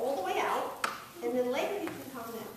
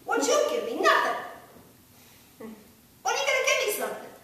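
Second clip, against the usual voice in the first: higher, louder, indignant demand; annoyed demand; 10 semitones higher, 8 dB louder than usual. Shown as a demonstration. Coach shows how far to push: loud indignant demand. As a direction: throw the grievance at the plant. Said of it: "You can go that far".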